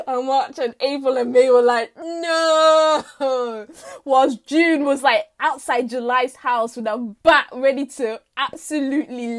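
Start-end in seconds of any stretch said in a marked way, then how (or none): 2.0-3.7 long, loud wailed "no"; hammed-up cry of horror; drawn-out protest. March, April and May seeing July open everything, wailed protest.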